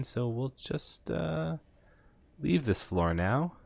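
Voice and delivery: slow spooky drawl; creepy voice. Acted. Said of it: "Get away from the creepy voice".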